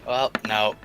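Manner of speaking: ordinary talk, nothing marked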